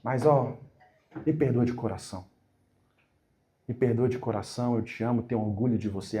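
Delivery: low, soft tender father's voice; teacher voicing the boy's father, tender and low. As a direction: speak as a loving dad asking forgiveness. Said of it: "imagine que é o seu pai que tá falando".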